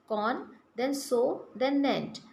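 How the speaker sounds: slow, with pauses between con, so and nent; syllable by syllable. Shown as a demonstration. Teacher to children, splitting consonant into syllables. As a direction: sound out consonant one syllable at a time. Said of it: "consonant into how many, uh, syllables".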